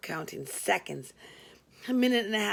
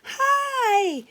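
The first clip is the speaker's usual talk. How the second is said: much higher, louder bright chirp; friendly chirp; 8 dB louder than usual. Acted. Cheery receptionist calling in late, chirping hello. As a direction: chirp it like a bubbly young woman. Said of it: "chirped a friendly voice".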